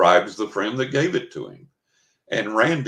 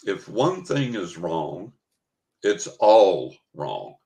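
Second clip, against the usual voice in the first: slow and deliberate, with "all wrong" pushed out louder; stern declaration; 3 dB louder, much slower than usual. Retold or imitated. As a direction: lay down the rule word by word. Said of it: "Randy declared".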